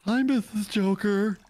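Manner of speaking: high, drawn-out hello; playful singsong greeting. Pretend. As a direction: call out slowly in a high put-on voice. Calls out greeting to friend's wife.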